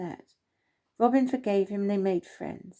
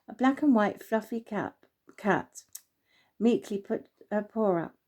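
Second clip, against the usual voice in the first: ordinary delivery, nothing marked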